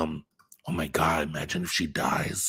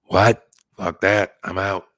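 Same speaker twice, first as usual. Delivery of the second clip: louder, blunt brush-off; dismissive refusal; 8 dB louder, slower than usual. Retold or imitated. Imagining the grown-up Reagan refusing to help, loud and dismissive. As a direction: toss it aside like she's done with it. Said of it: "is she gonna be like".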